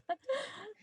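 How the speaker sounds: soft, high playful laugh. Acted. Felicity laughs playfully after telling John to shut up. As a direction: laugh as you pull him close.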